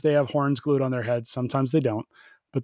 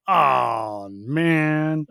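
mock dismay. Riders drawn guns, disappointed reaction.

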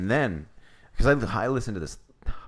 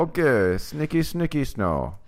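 higher-pitched mock Jamaican accent; 5 semitones higher than usual. Riffing on [name]'s name, mock Jamaican accent. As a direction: keep up the silly Jamaican voice. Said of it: "The Jamaican man".

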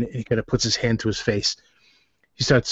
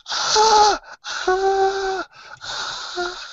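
very high-pitched repeated scream, fading; acted screaming; much slower than usual. Game master voices terrified boy, screaming. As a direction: scream like a tortured boy, dying away. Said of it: "screaming at the top of his lungs".

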